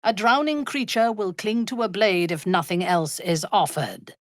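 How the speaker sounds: usual speaking voice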